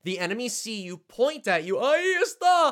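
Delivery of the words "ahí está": high, loud, slowed cry of there he is; enemy alert shout. Retold or imitated. Imitating the villagers spotting the player, shouted alarm. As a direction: yell it like a guard who just spotted you. Enemies spot and point at you.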